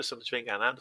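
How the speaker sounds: normal talking voice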